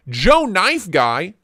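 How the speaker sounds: ordinary delivery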